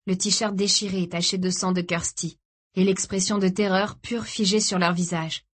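plain reading voice

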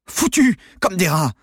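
high, loud exasperated outburst; worked-up. A narrator's reading. Trapped accomplice cursing their failure, worked-up outburst. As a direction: rage at the wasted effort. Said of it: "s'énerva Maxime Prieur".

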